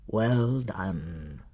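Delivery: deep, soft lion voice; Aslan. A narrator's reading. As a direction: give low, quiet praise as a great lion. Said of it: "the deep voice said".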